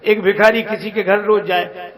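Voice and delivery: usual speaking voice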